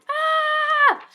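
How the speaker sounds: high, loud hammed-up scream of fright; mock scared scream. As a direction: shriek as if the lion just roared. Lion in cage, reader startles.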